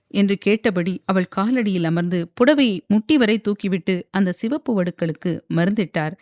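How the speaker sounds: ordinary delivery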